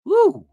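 high, loud "woo"; loud whoop. Real, not acted. Host reacting to the brother-in-law's warning, loud whoop. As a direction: let out a sudden high whoop.

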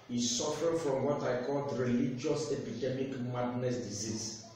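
ordinary delivery

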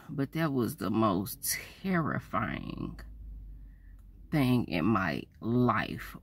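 hushed and grave. Real, not acted. Solemn summing up of terror.

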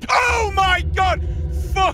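screaming in panic; terrified scream. Real, not acted. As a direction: scream at the jump scare. Scared by jump scare.

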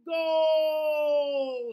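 loud, high triumphant shout. Acted. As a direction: yell it out in victory. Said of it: "she shouts".

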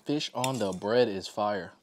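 talking with a full mouth. Real, not acted. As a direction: chew and talk at once. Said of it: "I keep talking with my mouth full".

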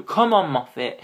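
loud, high exclamation; excited urging. Real, not acted. Fan urging Moffat to make it true, loud pleading call. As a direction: call out to egg someone on.

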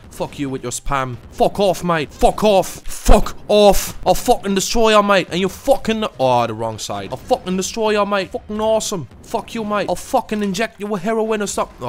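high, loud put-on Aussie trash talk; mock Aussie accent. Gamer taunting his opponent in a hammed-up Aussie accent. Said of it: "Should I try to talk like this the whole video? I'll try".

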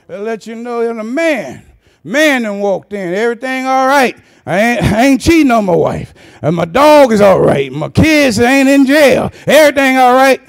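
loud, proud reassurance; hearty greeting. Retold or imitated. Man greeting a brother on the street, loud and proud. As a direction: announce that all is well, loudly. Voicing men greeting each other.

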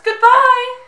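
high, loud princess goodbye; Disney princess voice. Acted. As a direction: trill it like a cartoon princess. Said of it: "I sound like a Disney princess".

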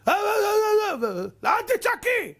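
hammed-up high yelling with long wails; mock screaming. Husband yelling at his wife not to yell, mock screaming. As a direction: imitate a shrieking spouse, very high-pitched. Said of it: "אתה רואה אנשים היום צועקת".

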